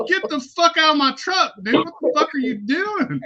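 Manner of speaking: high-pitched indignant mock yell; retold angry outburst. Driver to a friend smoking crack in the truck, outraged. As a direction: throw him out, voice jumping high.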